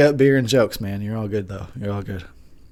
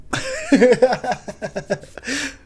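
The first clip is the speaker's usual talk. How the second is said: high giggle; 13 semitones higher than usual. Real, not acted.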